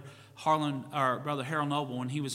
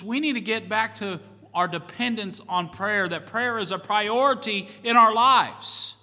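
preaching, building higher and louder toward the end; rising to a fervent climb, pounding each plea; full-force preaching; 6 semitones higher, 7 dB louder than usual. Acted. Pastor urging the congregation to pray, preaching at full force.

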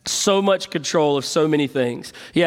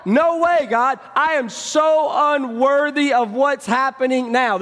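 higher, a pleading disbelief to God; voicing an overwhelmed believer; 7 semitones higher than usual. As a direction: play a man stunned by his own unworthiness. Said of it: "felt that kind of weight".

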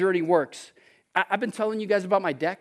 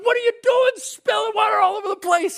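high, loud retold yell at the kids; mock angry yell; 14 semitones higher, 4 dB louder than usual. Pastor retelling how he yelled at his kid over spilled water, high loud yell. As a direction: bark it at the kids, hammed up. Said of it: "start yelling at him".

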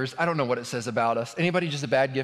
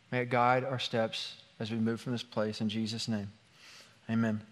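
soft, subdued praying voice; prayer; 3 semitones lower, 6 dB quieter than usual. Real, not acted. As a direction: pray quietly for the room, in short unhurried phrases. Leads the congregation in prayer.

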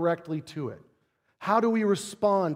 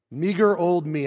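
ordinary talk, nothing marked

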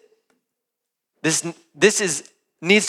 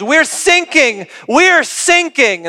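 much higher, slow drawn-out cry for help; acted distress call; 9 semitones higher, 4 dB louder, slower than usual. Sinking sailor to the coast guard, mock desperation. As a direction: stretch each word in mock panic. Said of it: "the voice on the radio goes".

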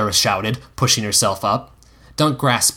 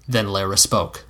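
ordinary delivery

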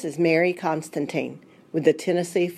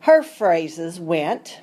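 usual speaking voice

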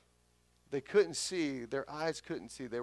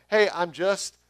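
mock casual; 3 semitones higher, 11 dB louder than usual. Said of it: "what he's saying isn't".

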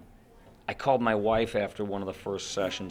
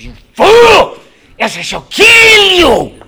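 shrieking, much higher and louder cartoon fury; Ren screaming; 18 dB louder, slower than usual. Ren screaming death threats at Stimpy, a maniac shriek. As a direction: scream it to curl your hair, slow on the threat. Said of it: "real screaming".

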